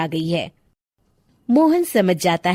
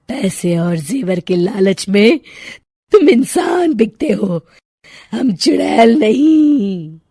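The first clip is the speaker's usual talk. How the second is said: slow, louder scornful witch; 3 dB louder than usual. Acted. Witch scorns the thieves' bribe, slow and menacing. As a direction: sneer it out slowly as a witch.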